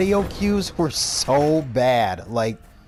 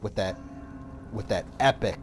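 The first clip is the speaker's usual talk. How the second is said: ordinary talk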